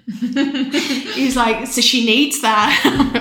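slow, laughing quote. Real, not acted. Quoting her husband to the doctors while laughing. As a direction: laugh through the words.

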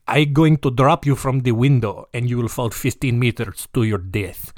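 hammed-up Russian-accented threat; mock villain threatening in a put-on Russian accent; comic, rough attempt at a Russian accent. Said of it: "That's almost Russian".

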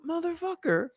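high, mock-outraged aside; exasperated inner voice. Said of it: "I'm like".

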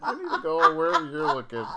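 high, laughing hard; blurting it through laughter. Real, not acted.

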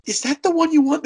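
imitating a doting grandmother, pitched high; grandma voice. Retelling the sweet grandma at the counter, in a high voice. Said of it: "And she just goes".

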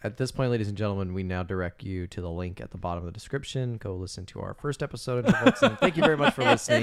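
fast, hammed-up podcast outro; mock announcer. Podcast host jokingly ending the episode, mock announcer. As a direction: wrap up the show like a host.

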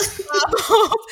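high, laughing echo of the name; laughing quote. Retold or imitated. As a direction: giggle through the name. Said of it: "random things stick".

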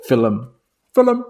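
mock Irish fil-um; film in two syllables, Irish style. Shown as a demonstration. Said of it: "he really emphasizes the".

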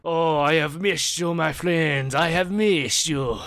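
high, loud, overjoyed French baker; hammed-up French chef welcoming a friend; jolly chef greeting. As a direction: burst out in delight at an old friend. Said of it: "He's like".

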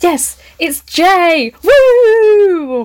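high, loud, drawn-out cheer; excited cheer. Real, not acted. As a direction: whoop with delight. Excited at Jay arriving.

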